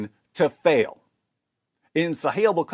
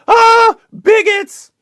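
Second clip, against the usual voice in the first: high shrieking mock scream; hammed-up high panicked yell; 9 dB louder, much slower than usual. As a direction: shriek it like a hysterical reporter. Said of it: "comes back to us and screams".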